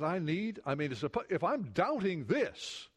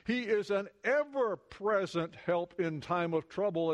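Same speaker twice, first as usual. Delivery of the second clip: normal talking voice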